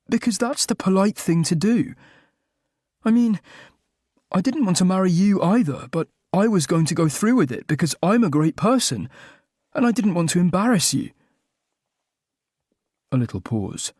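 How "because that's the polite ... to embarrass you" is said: raised pitch, near-normal volume; high-pitched. A narrator's reading. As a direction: pitch shoots up in flustered protest. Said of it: "My voice rose, in pitch, if not in volume".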